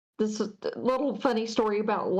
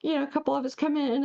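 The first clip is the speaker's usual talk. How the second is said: ordinary delivery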